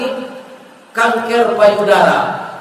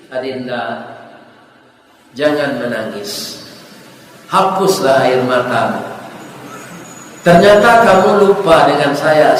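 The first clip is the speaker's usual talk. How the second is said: tender note; 7 semitones lower than usual. Acted. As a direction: speak it like a caring older brother. Doctor's note to the girl. (suara lembut penuh kasih)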